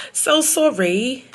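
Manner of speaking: loud, drawn-out, high-pitched sorry; over-the-top fake apology; exaggerated mock apology. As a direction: stretch it out high and loud, hamming it up.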